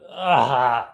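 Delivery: loud anguished ugh, half crying; frustrated groan. Real, not acted. Stuck for a topic, asking the therapist, loud groan.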